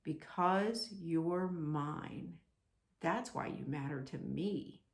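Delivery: very slow, soft and tender; slowly, each word laid down with care. Acted. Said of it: "he spoke very slowly".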